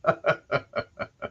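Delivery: breathy delighted laugh of a jolly father; dad's gleeful laugh. Retold or imitated. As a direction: laugh at the kid's thick mayo. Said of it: "kill himself laughing".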